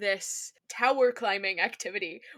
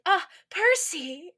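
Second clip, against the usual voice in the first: swooning; 7 semitones higher, 4 dB louder, much slower than usual. Retold or imitated. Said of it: "very wistful".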